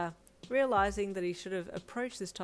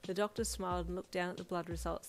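plain reading voice

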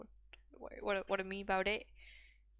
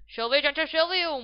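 high, loud call of the shooter; excited commentator shout; 7 semitones higher, 13 dB louder than usual. Acted. Gamer commentating a big shot, excited shout. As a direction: shout the name as the shot flies.

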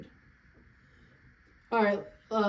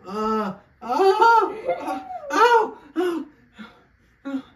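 loud pained cries with laughter; groaning through the cramp; stomach cramps; 13 semitones higher, 9 dB louder, much slower than usual. Real, not acted. Simulator at level six.

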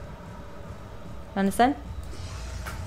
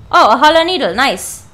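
ordinary talk, nothing marked

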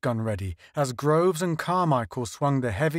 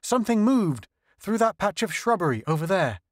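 higher, tense report; urgent warning; 7 semitones higher than usual. A narrator's reading. Scylla warning the others of movement, urgent. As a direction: point it out fast and tense.

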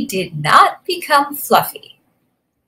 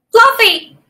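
high, quick squeak of a small creature; little porcupine; 11 semitones higher, 5 dB louder than usual. A narrator's reading. Fluffy answers the big rhinoceros, small high voice. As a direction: pipe up high and loud. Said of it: "said Fluffy".

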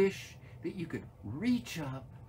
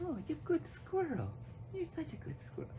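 very high, soft cooing; pet praise; 12 semitones higher, 5 dB quieter, faster than usual. Real, not acted.

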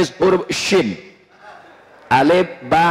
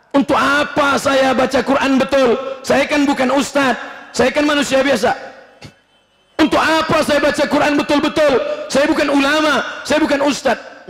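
higher, complaining voice of an ordinary man; whiny layman; 7 semitones higher than usual. Acted. Preacher voicing a man who won't learn recitation, whiny and higher. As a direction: play the lazy excuse-maker.

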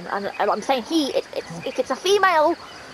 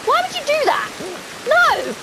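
scolding outburst; 13 semitones higher than usual. Real, not acted. Streamer scolding a misbehaving monkey, high exasperated cry. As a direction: tell off a naughty pet, shocked and cross. Monkey does something bad.

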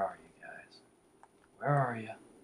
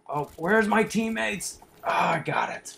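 panicked yell; 12 semitones higher, 10 dB louder than usual. Real, not acted. Alone during a fight.

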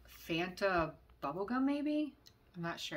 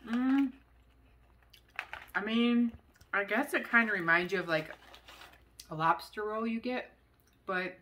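talking between chewing bites; crunchy snack in mouth; mouth full of chips; faster than usual. Real, not acted. Seen 3: Chews at start, bites chip near 9s, keeps chewing after.

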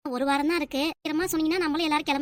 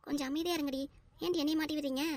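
much softer, pleading under the breath; hushed aside; 8 dB quieter than usual. Acted. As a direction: quietly shush the girls for telling on you. Mother's aside hushing her daughters.

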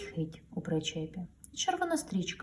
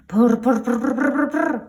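loud higher rotor buzz coming closer; louder helicopter; 7 semitones higher, 10 dB louder than usual. A narrator's reading. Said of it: "гучніше він наближався". (гучніший гул вертольота)

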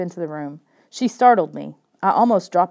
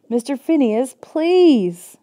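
desperate pleading shout; 6 semitones higher, 5 dB louder, much slower than usual. Acted. Chasing runaway dog.

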